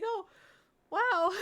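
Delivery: very high, loud squeaky awe; awkward amazement. Retold or imitated. Streamer answering a fan, awkward amazement. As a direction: squeal it as if flustered.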